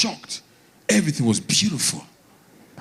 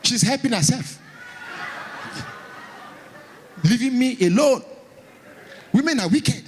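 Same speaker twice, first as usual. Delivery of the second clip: indignant high-pitched telling; 4 semitones higher than usual. Real, not acted. Raised-pitch indignant storytelling.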